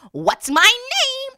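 high, loud, slow impish little man; the little man demands his name, high and loud. Acted. Little man voiced in story.

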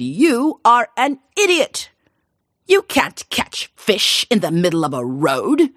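scornful scolding. Acted. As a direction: spit out the insult slowly, then lecture him. King scolding the fisherman.

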